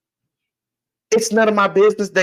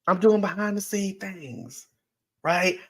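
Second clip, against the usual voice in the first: hushed repeat; 3 semitones higher, 8 dB quieter than usual. Real, not acted. Repeating his point to the audience, softer and knowing. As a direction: drop it softer for emphasis. Repeats line quieter for emphasis.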